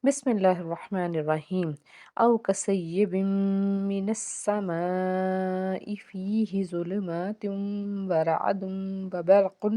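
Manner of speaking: slow, drawn-out chanted recitation; Quran recitation. Shown as a demonstration. Teacher reciting the verse aloud to students, slow chant. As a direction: recite the verse with tajweed, slowly. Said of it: "آیت number انیس کا ایک حصہ کور کرتے ہیں".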